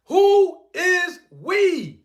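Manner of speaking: slow sarcastic repeat; drawn-out mocking. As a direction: stretch each word out in disbelief.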